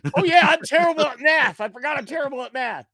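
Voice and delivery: high, loud mock dawning; sudden realisation. Co-host reenacting how they realised the game was won, sheepish outburst. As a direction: blurt it high, then rush the admission.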